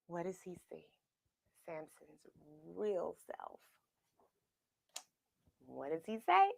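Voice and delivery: fast soft whisper; hushed suspense. A narrator's reading. Narrator hushing listeners before the reveal.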